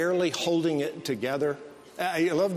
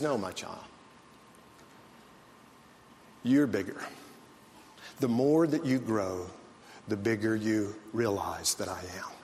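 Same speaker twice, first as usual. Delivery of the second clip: a lightly voiced gentle father, lower and softer; fatherly voice; 7 semitones lower, 4 dB quieter than usual. Preacher voicing God answering his child, softly low. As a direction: speak down tenderly, like a patient father to a small child.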